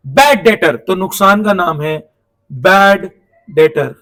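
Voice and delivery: डेटर said as "detter", the b left silent. Shown as a demonstration. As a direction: say डेटर with no b sound. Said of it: "डेटर में ना बी silent होता है". (डेटर बिना बी की आवाज़ के)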